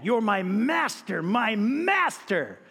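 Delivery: slow, drawn-out stress on "master" both times; emphatic repetition. Shown as a demonstration. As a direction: lean hard on each "master", stretching it. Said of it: "way of emphasizing that".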